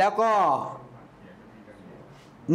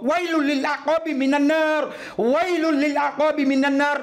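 raised, higher call said twice; loud repeated warning; 5 semitones higher than usual. Retold or imitated. Preacher voices the prophet warning his companions loudly. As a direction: call the warning out like across a crowd. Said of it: "พูดถึงสองหรือสามครั้ง ใช้เสียงดังด้วย".

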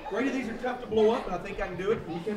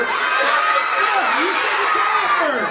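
mock protest; 5 semitones higher, 5 dB louder, much slower than usual. Mock argument over tying the balloon.

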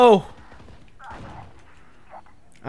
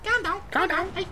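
mock Scouse. Mocking a Scouse voice.

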